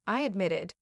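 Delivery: ordinary delivery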